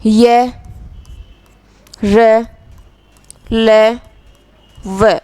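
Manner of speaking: य, र, ल, व as held, separate syllables with pauses; letter-sound recital. Shown as a demonstration. Teacher lists the four letters for students, sounding out य, र, ल, व separately. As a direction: say य, र, ल, व one by one, drawn out. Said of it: "जैसे अब उच्चारित करके देखो".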